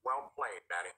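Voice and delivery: deep smug mutter; very deep low. Acted. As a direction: drop far down low, smug. Jeffy drops very low.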